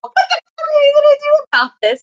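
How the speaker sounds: high, loud mock yell; retold irate shout. Another fan yelling after the staffer, outraged. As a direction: imitate a bystander shouting in outrage.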